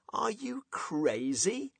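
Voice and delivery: high, drawn-out disbelief; slow, high incredulous question. Acted. Friend shocked at wife in joke.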